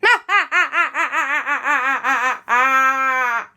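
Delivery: long, loud staccato villain laugh; evil Martian cackle. Acted. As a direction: cackle over your captives. Martian gloats over prisoners.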